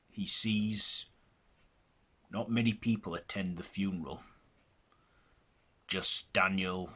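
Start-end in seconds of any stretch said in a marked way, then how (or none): none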